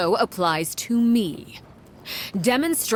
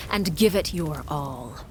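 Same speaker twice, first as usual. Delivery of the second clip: usual speaking voice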